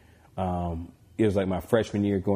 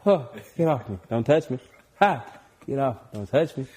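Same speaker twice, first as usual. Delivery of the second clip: mock trash talk; 4 semitones higher than usual. Acting out playground trash talk.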